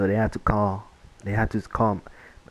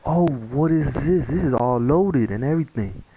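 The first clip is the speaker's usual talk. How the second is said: mock astonishment; 5 semitones higher than usual. Finding loaded BB guns in the bag, imitated exaggerated astonishment. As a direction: imitate an exaggerated shocked discovery.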